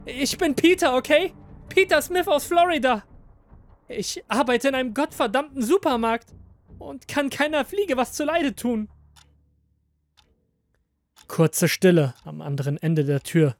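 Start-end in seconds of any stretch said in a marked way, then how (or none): 0.1-8.9 high, loud, exasperated insistence; emphatic protest. A narrator's reading. Prisoner proving he is just Peter, exasperated. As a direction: insist on your innocence.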